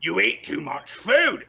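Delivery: slow commanding bark at the goblins; bugbear voice. Acted. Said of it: "He's like".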